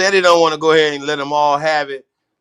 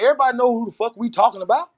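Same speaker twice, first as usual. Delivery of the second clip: normal talking voice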